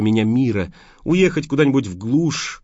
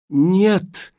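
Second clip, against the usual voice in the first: cry out; 9 semitones higher, 3 dB louder than usual. A narrator's reading. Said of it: "Я закричала".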